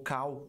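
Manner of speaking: "cow" as a wrong "call"; common error. Shown as a demonstration. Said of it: "que aí soa como vaca".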